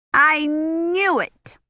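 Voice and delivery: high, very slow fretful wail; a busybody storming in, sure of the worst; fussy old woman. A narrator's reading. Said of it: "steaming down the corridor toward them".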